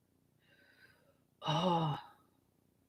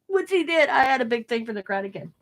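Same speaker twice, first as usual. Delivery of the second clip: ordinary delivery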